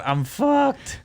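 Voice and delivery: high-pitched retold dismay; hammed-up doomed wail; mock despair. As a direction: imitate yourself realizing it's lost. Quotes own panicked reaction to play.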